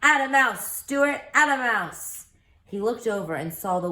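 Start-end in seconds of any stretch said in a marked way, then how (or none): 0.0-2.3 high, loud crowd cheer. Acted. People on shore cheer Stewart on, a loud high call. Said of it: "cheering for him and calling".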